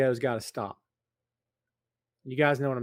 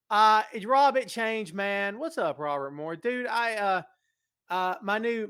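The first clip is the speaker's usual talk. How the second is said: ordinary talk, nothing marked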